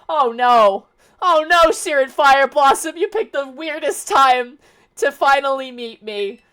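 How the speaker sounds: loud, high "oh no" in fake alarm; mock panic. Real, not acted. Streamer startled by a follower alert, loud high mock panic.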